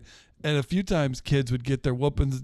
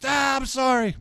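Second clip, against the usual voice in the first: high, squeaky little sorry; mock childish apology; meek kid apology; 12 semitones higher than usual. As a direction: whimper the apology like a scared kid. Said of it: "and I'm like".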